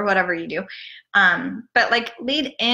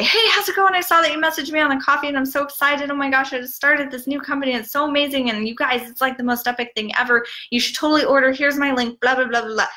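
high-pitched, rushed, gushing pitch; mock word-vomit sales pitch; 6 semitones higher, faster than usual. Pushy seller spamming a stranger's messenger, over-excited and rushed. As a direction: gush it all out like an overeager seller. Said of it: "just word vomit all over their".

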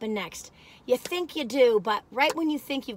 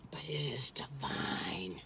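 slow, low reverent whisper; 11 semitones lower, 14 dB quieter, slower than usual. Real, not acted. Praising the cookie to viewers, low whisper. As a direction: breathe it like a secret.

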